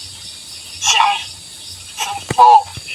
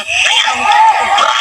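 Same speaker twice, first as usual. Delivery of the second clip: laughing shout; 13 semitones higher, slower than usual. Real, not acted. Shows cobra, laughing shout.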